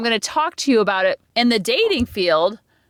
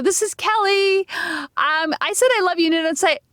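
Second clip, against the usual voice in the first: put-on Valley girl; 6 semitones higher than usual. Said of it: "I don't know if I sound like a Valley girl".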